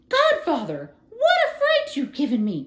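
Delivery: high, loud startled cry with a gasp of laughter; frightened cry. A narrator's reading. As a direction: cry out after a sudden fright. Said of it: "cried Marie".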